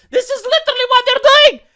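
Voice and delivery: high, loud exasperated cry; outraged shout. Real, not acted. YouTuber shouting at the news. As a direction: yell it in disbelief. Outraged exclamation about article.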